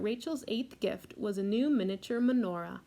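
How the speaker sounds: ordinary delivery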